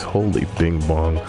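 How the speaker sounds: ordinary delivery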